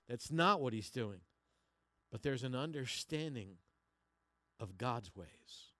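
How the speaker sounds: ordinary talk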